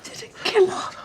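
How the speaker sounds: high, breaking sob on the words; tearful denial. Acted. Accused woman telling her partner she didn't do it, sobbing. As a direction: deny it through tears.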